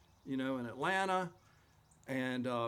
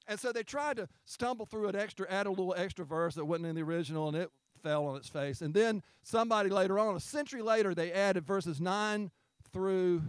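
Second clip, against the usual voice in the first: normal talking voice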